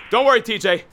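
high urgent comfort; frantic reassurance. Acted. Reassuring the victim.

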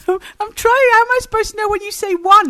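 high, loud exasperated outburst. Real, not acted. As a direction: protest loudly at your partner, high and quick. Frustrated at failed count-in.

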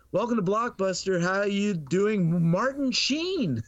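acted greeting slowing into high, drawn-out astonishment; stunned greeting. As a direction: start the rote welcome high, then stretch it out, starstruck. Routine greeting turns into stunned recognition.

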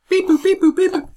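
high electronic beeps; chirpy robotic beep boop; toy robot voice. Pretend. Mimicking phone dialing tones.

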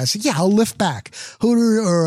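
normal talking voice

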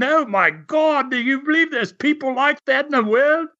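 high-pitched, incredulous audience reaction; shocked disbelief. Retold or imitated. Acting out a shocked Australian audience.

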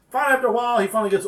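ordinary talk

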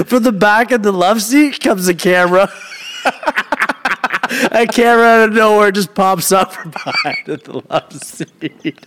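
high-pitched, laughing through the words; real helpless laughter while telling; cracking up. Real, not acted. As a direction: struggle to get the punchline out. Cracking up at his punchline.

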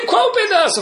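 lightly voiced student asking which passage; high questioner voice. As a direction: pipe up like a curious student.

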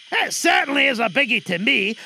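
ordinary talk, nothing marked

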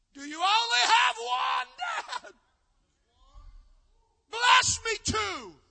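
high, loud pleading cry, slowing on the last words; anguished plea. Retold or imitated. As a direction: beg the father with a breaking, desperate cry. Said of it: "And he wept loudly".

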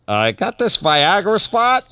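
high, slow mock son voice. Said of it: "he goes".

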